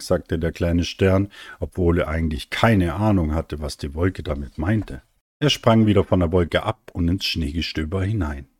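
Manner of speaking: usual speaking voice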